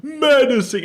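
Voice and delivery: high, loud mock menace; menacing. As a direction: shout it like a cartoon villain. Repeating the meme word.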